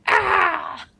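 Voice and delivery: high short fox screech; comedy screech. Shown as a demonstration. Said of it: "kinda like".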